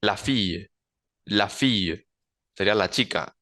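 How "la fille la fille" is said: la fille with fille as fiye, said carefully; French demo. Shown as a demonstration. Teacher modelling la fille for students. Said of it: "en el femenino singular sería la".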